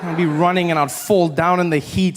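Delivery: normal talking voice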